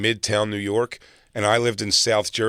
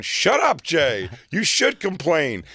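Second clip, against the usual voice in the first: imitating pushy friends, pitched up high; forceful bullying; 6 semitones higher, slower than usual. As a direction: talk right over him, bossy and insistent. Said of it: "forceful. They're bullying me".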